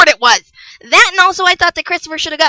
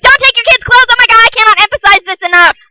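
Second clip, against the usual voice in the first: loud, high-pitched outburst; exasperated yell; 9 semitones higher, 6 dB louder than usual. Real, not acted. Vlogger yelling at parents about kids' clothes, exasperated. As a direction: yell it out fast, at the end of your patience. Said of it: "me yell about people's clothes and parenting".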